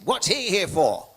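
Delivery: retold loud, high-pitched question from an old colonel; old colonel's demand. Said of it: "this really old man".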